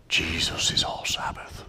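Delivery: put-on theatrical pious British accent; breathy British accent, whispered piously. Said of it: "slip into a British accent for theatrics".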